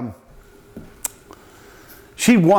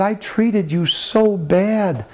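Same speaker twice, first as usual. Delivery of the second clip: tearful confession; 6 semitones higher, much slower than usual. Retold or imitated. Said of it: "he started crying like a baby".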